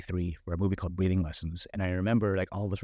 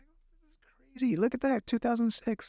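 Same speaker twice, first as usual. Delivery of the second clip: much higher, slow, awed delight; amazed; 13 semitones higher, slower than usual. Retold or imitated. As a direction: marvel at it like seeing yourself on screen. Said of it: "And I was like".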